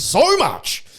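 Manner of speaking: high, drawn-out exasperated vent; worked-up, high-pitched griping. Real, not acted. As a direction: let the irritation push your pitch way up. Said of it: "She triggers me".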